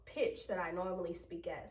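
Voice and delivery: ordinary delivery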